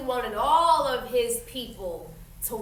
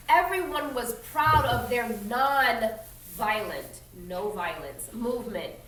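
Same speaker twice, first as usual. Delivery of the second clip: reading a picture book aloud to the class; steady storytelling voice for children; story narration; 5 semitones higher, 5 dB louder than usual. A narrator's reading. Teacher reading a history story aloud to her students, in a clear storytelling voice.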